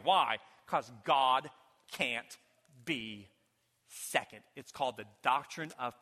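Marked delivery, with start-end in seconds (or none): none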